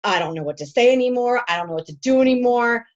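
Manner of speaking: mild mock panic, pressing on each complaint; retold freak-out. Coach retells her private birthday freak-out to her team, mildly panicked. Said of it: "nobody sees me freaking out, but I'm freaking".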